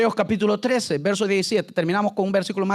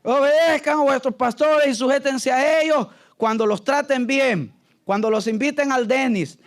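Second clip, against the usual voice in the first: raised preacher's declamation; mock scripture reading; 3 semitones higher than usual. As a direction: pretend to proclaim a Bible verse. Mock-reading an altered verse.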